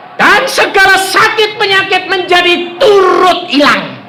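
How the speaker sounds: fervent sermon delivery; forceful preaching. Real, not acted. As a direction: drive it home to the congregation.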